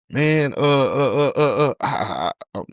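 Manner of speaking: nervous stammer. Retold or imitated. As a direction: stall for time, tripping over every word. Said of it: "Dude, why you nervous?".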